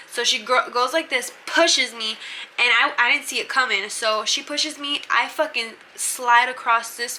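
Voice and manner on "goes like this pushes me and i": ordinary talk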